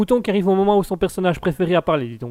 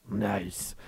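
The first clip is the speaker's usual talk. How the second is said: soft running-gag catchphrase impression; Asketil; 12 dB quieter than usual. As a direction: murmur it softly, imitating a colleague's catchphrase. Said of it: "Asketil le fait mieux que moi".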